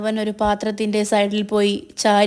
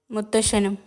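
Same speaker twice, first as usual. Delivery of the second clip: ordinary delivery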